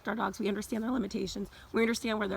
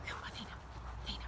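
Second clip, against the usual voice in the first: whisper; 13 dB quieter, much faster than usual. Real, not acted. Whispering so dogs won't overhear.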